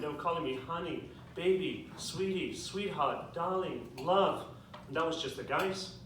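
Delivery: ordinary talk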